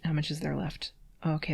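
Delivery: ordinary delivery, nothing marked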